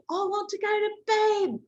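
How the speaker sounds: very high-pitched mock kid's shout; shouting British child. Child screaming into a microphone about bedtime, shouted British kid voice. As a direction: wail it like an overtired British little boy. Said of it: "But he's shouting".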